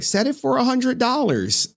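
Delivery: high mock voice for the chat. Streamer mimicking chat's hundred-dollar demand, high and mocking. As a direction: squeak it out like a greedy viewer.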